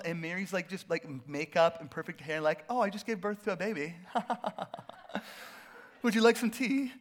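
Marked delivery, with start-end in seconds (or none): 2.7-6.9 mock posh English accent offering tea; a light English lady's voice, laughing. Preacher imitating Mary in a mock English accent. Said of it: "I thought she's English all of a sudden".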